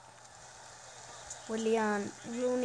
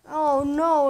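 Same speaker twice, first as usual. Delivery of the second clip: louder, higher groan of dismay; dismayed cry; 6 semitones higher, 13 dB louder than usual. Real, not acted. Gamer watching the goal go in, loud dismay. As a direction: wail it as the ball goes in.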